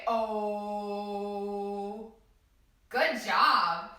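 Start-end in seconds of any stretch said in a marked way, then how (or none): 0.1-2.1 drawn-out soft oh; long oh sound. Shown as a demonstration. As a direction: hold a round oh. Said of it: "Can you say".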